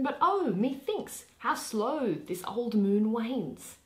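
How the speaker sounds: lightly voiced noble duke, proud and eager; stately duke Theseus. Duke Theseus to his bride Hippolyta, lightly voiced stately declaiming.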